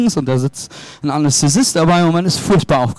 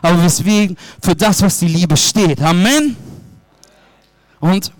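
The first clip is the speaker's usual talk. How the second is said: raised, driving preacher's voice, pushing each phrase; fervent preaching; 4 semitones higher than usual. Acted. Pastor urging the church to share God's love, fervent preaching.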